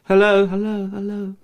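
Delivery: high calls repeated like an echo, dying away at the end; imitating an echo. Actor mimicking his echo in the empty kitchen, high calls fading out. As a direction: play your own echo bouncing back, fading. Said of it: "all you could hear was my echo".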